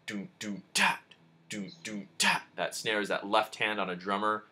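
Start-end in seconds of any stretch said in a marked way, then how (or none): 0.1-2.4 vocal drum beat. Shown as a demonstration. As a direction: mouth the groove like a drummer. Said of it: "like, the snare".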